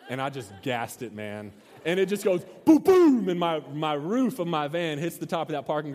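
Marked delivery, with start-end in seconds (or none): 2.6-3.3 booming crash noise, higher and louder; loud imitated boom. Storyteller imitates roof smashing garage ceiling, loud booming crash noise. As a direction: bang it out like metal hitting concrete.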